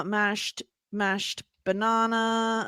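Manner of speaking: put-on slow Australian-accented "mashed banana"; slowly savouring the Australian "banana"; drawn-out Australian banana. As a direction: stretch "banana" with a broad Australian ah. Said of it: "the way they say it".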